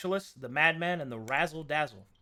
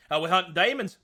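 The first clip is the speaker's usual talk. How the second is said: loud, raised Australian demon hunter; broad Australian, higher and louder; Australian accent; 4 semitones higher, 7 dB louder than usual. Acted. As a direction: play a demon hunter gone full Aussie. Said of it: "will just become Australian".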